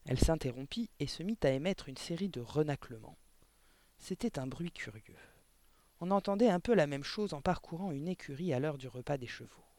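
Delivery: ordinary delivery, nothing marked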